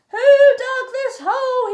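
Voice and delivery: loud, high bellow. A narrator's reading. Captain fallen in a hole, loud bellow. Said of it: "he bellowed".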